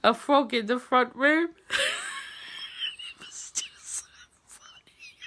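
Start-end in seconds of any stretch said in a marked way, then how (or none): 1.7-5.2 breathless, almost voiceless squeak; whispered through laughter. Real, not acted. Wife telling of the frog hunt, breathless whisper through laughter.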